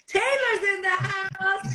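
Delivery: high, drawn-out cheering announcement; excited hail. Real, not acted. Host welcoming the guest on, excited high hail. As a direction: hail the arrival in a high, slow call. Hailing the guest as she joins.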